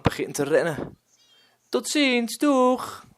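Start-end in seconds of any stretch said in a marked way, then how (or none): 1.7-3.0 put-on high, bright little boy calling out; cheerful child calling. Boy runs off calling goodbye over his shoulder, high child call. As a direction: sing out a goodbye while running off. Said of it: "roept hij over zijn schouder".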